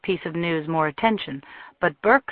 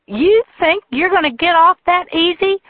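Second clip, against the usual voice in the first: shrill, much higher furious shout; furious woman; 13 semitones higher, 7 dB louder than usual. A narrator's reading. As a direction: hurl it at him, loud and high. Jilted woman rages at Tucker.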